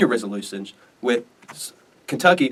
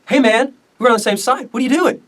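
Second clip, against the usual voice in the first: high-pitched, incredulous challenge; acted protest; 11 semitones higher, 4 dB louder than usual. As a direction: plead in a high voice with an ally. Jackson confronting Calhoun, acted.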